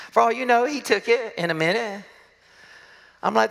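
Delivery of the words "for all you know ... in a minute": ordinary delivery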